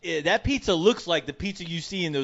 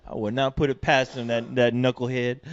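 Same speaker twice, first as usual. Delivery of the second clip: ordinary talk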